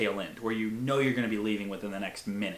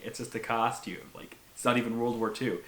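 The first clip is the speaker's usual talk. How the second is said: normal talking voice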